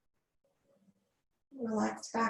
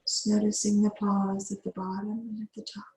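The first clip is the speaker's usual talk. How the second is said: guided meditation. Acted. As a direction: ease the class into calm breathing. Yoga teacher leads breathwork.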